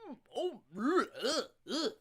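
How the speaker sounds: retching ughs; disgusted groans. Retold or imitated. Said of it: "So I'm like".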